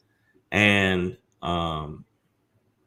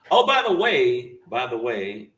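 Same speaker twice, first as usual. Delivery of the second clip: normal talking voice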